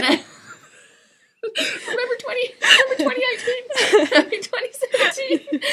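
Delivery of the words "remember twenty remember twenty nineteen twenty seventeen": high, laughing through tears. Real, not acted. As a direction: choke out the years between laughs. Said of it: "Are you sure about that?".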